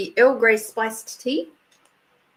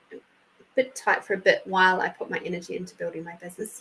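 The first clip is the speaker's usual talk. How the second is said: usual speaking voice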